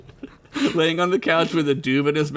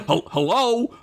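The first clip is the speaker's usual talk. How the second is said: put-on higher-pitched Italian-accented hologram; 7 semitones higher than usual. Hologram checking the connection, Italian accent. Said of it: "I gotta do an Italian accent now".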